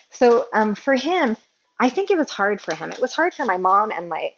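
usual speaking voice

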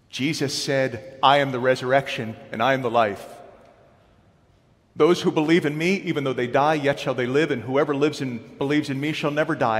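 loud, high, ringing scripture reading; preaching at full force. Acted. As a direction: proclaim it to the whole congregation.

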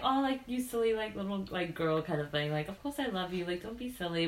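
soft, low, fond reassurance; endearing. Retold or imitated. Edward reassuring the girl he loves, softly endearing. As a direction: gently play down her worry, quiet and low. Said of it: "it's that type of way".

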